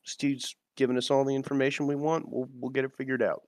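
softly confiding, for one listener only; conspiratorial whisper. Acted. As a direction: lean in and keep it quiet. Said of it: "whisper, like".

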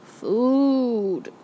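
Food groaned out slow and drawn, a dazed moan; barely awake, moaning for food; weak groan. A narrator's reading. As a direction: mumble it half-conscious, groaning. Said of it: "Then he groaned".

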